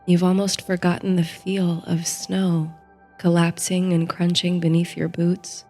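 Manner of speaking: ordinary delivery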